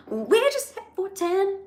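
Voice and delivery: excited squeal. Real, not acted. Host thrilled by record live viewers, squealing. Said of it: "I don't even know what to do".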